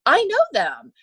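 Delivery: high, loud delighted I know them; imitating Clinton. Clinton recognizing the Rangerettes, loud and delighted. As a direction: ham up his pleased recognition. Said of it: "And he goes".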